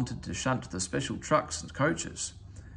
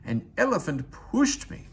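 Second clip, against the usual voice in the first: higher, lightly voiced indignant engine; cross hiss; 10 semitones higher than usual. A narrator's reading. Henry cross after the elephant soaked him, higher sulky complaint. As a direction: spit it out, offended. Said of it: "he hissed".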